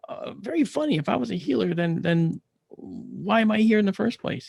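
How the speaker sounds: high-pitched, sarcastic comeback; exasperated retort. Retold or imitated. Patient answering being called a healer, exasperated. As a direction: push back sarcastically, voice lifted high.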